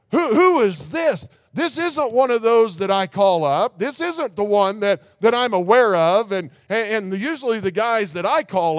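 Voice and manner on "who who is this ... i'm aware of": higher, louder mock alarmed cry of the witch; imitating alarm. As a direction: play her frightened, crying out in horror. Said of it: "She is crying out in horror".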